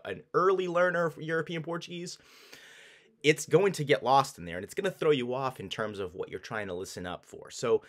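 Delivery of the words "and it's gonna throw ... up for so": ordinary delivery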